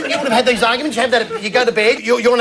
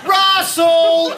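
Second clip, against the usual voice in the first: long, high-pitched call; yelled name; 7 semitones higher than usual. Retold or imitated. Partner calling him from another room, high yell. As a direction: holler it through the house. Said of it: "but they yell out".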